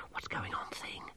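very soft unvoiced whisper. A narrator's reading. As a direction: whisper it secretly to a companion. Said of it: "Masklin whispered".

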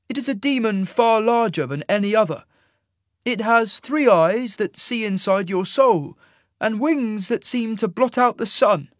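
high-pitched, loud immortal; commanding immortal. A narrator's reading. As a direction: question and describe with high, solemn authority. Isaac's character voice.

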